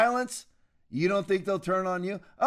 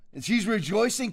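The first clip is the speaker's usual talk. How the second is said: normal talking voice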